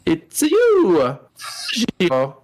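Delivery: a bad Italian accent; mock Italian accent. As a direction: cry it out like a cartoon Italian. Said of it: "doing a terrible accent".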